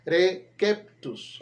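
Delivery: "receptus" slowly, the C said as K, "rekeptus"; "receptus" with a K sound; hard C. Shown as a demonstration. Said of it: "la C siempre debe sonar como una K".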